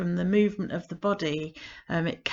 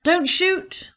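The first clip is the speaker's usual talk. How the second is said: much higher, louder little voice; watch hands' voice; 11 semitones higher, 5 dB louder than usual. Acted. The watch hands surrendering, high and loud. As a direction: squeak it like tiny hands raised.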